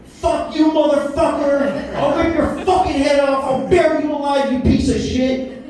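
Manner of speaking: hammed-up screaming rant; screaming dad. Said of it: "all of a sudden you hear".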